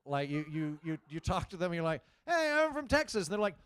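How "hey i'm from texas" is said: high-pitched, friendly hello; cheery, high mock introduction; chirpy greeting. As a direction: play the eager tourist, pitched way up. Acts himself greeting a Scot cheerily.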